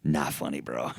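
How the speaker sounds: low, quiet unimpressed; dry, bored verdict; deadpan. Pretend. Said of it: "I'm over in the corner just like".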